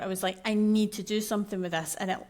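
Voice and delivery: usual speaking voice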